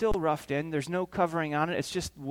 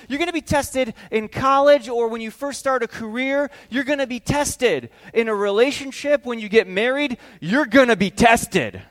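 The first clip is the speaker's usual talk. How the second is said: raised pitch, building refrain; emphatic preaching; 5 semitones higher, 4 dB louder than usual. Real, not acted. Preacher warning the congregation they will be tested, emphatic. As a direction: drive each repetition home, louder by the end.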